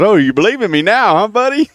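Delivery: high, gleeful mock ribbing; teasing singer on the phone. Country star teasing the doubting host on the phone, high mock teasing voice. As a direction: rub it in with a grin. [name] teasing, retold.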